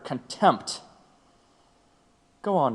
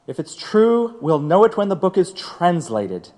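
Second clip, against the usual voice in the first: sharp loud enough, much higher; irate bench rebuke; judge's angry outburst; 4 semitones higher, 5 dB louder than usual. Acted. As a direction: slam the lawyer down. Judge silences defense.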